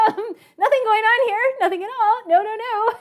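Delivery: mock innocence. Blocking the monitors from the professor, hammed-up innocent squeak. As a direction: play it as a kid caught red-handed.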